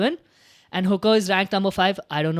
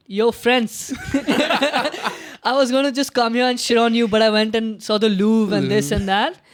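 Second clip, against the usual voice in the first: loud, higher imitation of a cage speech, laughing at first; hyped fighter speech; 3 semitones higher, 5 dB louder than usual. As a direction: shout it at the French crowd like the fighter did. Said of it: "He's like".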